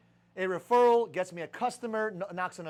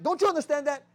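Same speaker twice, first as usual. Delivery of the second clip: usual speaking voice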